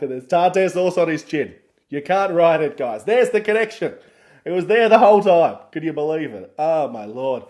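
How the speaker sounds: loud, high shout of mock amazement; excited outburst. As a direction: cry out as if the mystery is finally solved. Mock climax, connection finally found.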